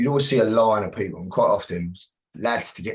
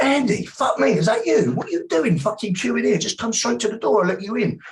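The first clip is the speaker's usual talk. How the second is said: much higher and louder, big surprised hello; loud friendly greeting; 13 semitones higher, 4 dB louder than usual. Retold or imitated. Bouncer hailing the bullied lad in the queue, loud and high. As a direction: call out to him like an old friend.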